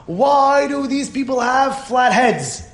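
high, slow, drawn-out silly question; mock-earnest pestering. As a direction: stretch it out to provoke the listener. Pesterer asks another stupid question.

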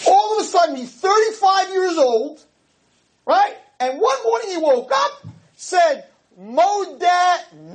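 high-pitched mock amazement; strained, high, exclaiming disbelief; incredulous ranting. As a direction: build the absurd story at the top of your voice. Ridicules a sudden loss of faith.

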